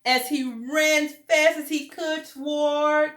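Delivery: high, loud, slow building call to mommy; joyful rush. A narrator's reading. Douglas running to his mommy, building to a loud high cry. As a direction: swell with joy as he runs.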